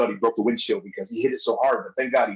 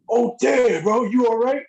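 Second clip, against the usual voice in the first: high, startled "you all right?"; a mock-worried check on a friend; worried quote; 11 semitones higher, slower than usual. Retold concerned quote from New Jack.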